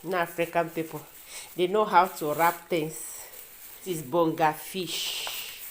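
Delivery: ordinary talk, nothing marked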